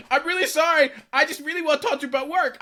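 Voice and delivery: high, urgent mock apology; pleading apology. Travel companion excusing a broken rule, pleading. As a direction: imitate a friend begging to be excused.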